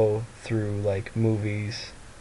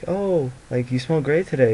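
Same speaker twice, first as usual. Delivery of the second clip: higher, pleasantly surprised compliment; lightly voiced secretary; 5 semitones higher, 4 dB louder than usual. Secretary complimenting her boss, lightly imitated delight. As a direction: imitate an impressed coworker, pitched up.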